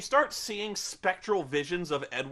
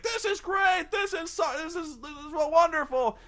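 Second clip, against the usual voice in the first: thrilled squeal; 10 semitones higher than usual. Retold or imitated. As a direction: gush with giddy excitement. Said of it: "she's like".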